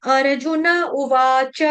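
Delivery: "अर्जुन उवाच" slowly, with a full a after न and च; full final vowels. Shown as a demonstration. Teacher to students, slow "अर्जुन उवाच" with full endings. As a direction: sound every syllable, ar-ju-na u-vaa-cha. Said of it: "अर्जुन का न पूरा".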